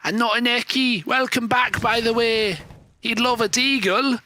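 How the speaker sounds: mock English caster voice; put-on English accent. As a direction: call the match in a hammed-up English accent. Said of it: "three different English accents".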